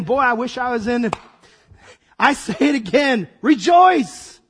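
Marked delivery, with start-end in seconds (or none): 2.2-4.4 louder, higher proclamation on Rejoice; emphatic command. Retold or imitated. Paul insisting on rejoicing, loud and high. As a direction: proclaim it with full force.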